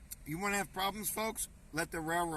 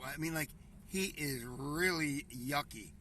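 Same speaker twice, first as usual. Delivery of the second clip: normal talking voice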